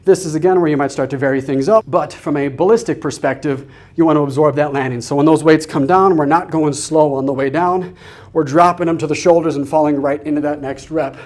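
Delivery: normal talking voice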